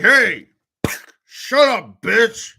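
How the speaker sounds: mock angry outburst. Imagined husband silencing his wife, acted anger. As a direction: act out telling someone off, loudly.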